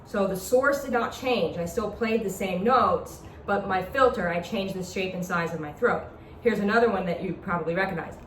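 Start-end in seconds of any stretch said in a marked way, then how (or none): none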